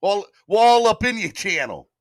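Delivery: high, loud put-on New York accent; badly faked New Yorker. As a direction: exaggerate the New York vowels. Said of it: "Gotta use my New York accent".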